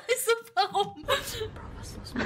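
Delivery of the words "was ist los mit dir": whisper. Real, not acted. Repeats the same line in a whisper.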